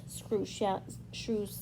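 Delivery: ordinary delivery, nothing marked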